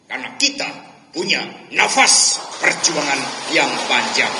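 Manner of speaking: loud, ringing words; emphatic quote. Retold or imitated. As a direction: proclaim it with force. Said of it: "Ibu Mega mengatakan".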